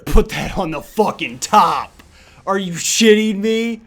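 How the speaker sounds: slow, loud, rising, disbelieving; building excited disbelief. Real, not acted. Streamer topdecks the winning card, slow loud disbelief.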